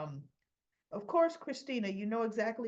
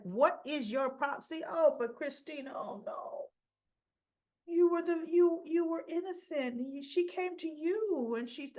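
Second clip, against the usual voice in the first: sarcastic mocking; 6 semitones higher than usual. Mocking Christina's story in high voice.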